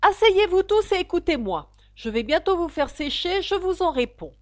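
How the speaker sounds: loud, high call to order; a small mouse commanding the crowd at full voice; crying out. A narrator's reading. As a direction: shout them all down from above. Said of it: "leur cria".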